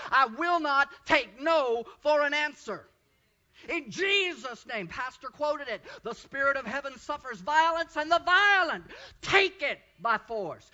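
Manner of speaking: loud, rousing pulpit cry; full-force preaching. Acted. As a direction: drive it home to the church.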